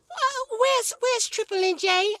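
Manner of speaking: rising alarm, searching; hint of a frantic wife; wife's voice. Wife asking about baby.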